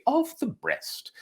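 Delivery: high, slow, posh-sounding British "of the breast"; mock British accent. Shown as a demonstration. A podcast host hams up a British accent for fun. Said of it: "add a little accent for no reason".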